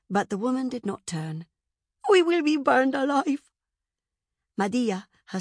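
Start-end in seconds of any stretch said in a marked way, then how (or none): 2.1-3.5 high, loud servant's wail; terrified scream. A narrator's reading. Servant behind the princess amid flames, screaming. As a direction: cry out in terror. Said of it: "screamed behind her".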